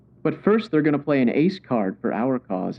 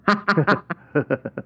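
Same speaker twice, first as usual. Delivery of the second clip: villain chuckle; 3 semitones higher than usual. Acted. Villain gloats over plan.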